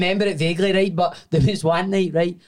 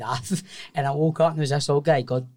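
normal talking voice

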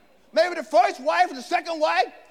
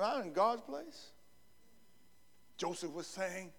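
much softer and lower, slow humble confession; quiet, lowered voice of a humble man; humble Joseph; 5 semitones lower, 10 dB quieter than usual. Retold or imitated. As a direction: drop right down and speak it meekly.

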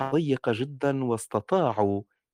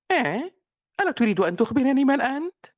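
high, curious little bunny asking; little rabbit voice; 13 semitones higher, 4 dB louder than usual. Shown as a demonstration. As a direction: ask like a small wondering rabbit. Rabbit speaks to the moon.

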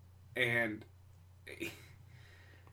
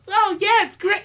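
much higher falsetto woman voice; mock woman; 10 dB louder than usual. Woman chiming in over the man in mock falsetto. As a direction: squeak it like a chirpy woman. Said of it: "the chick interrupts and says".

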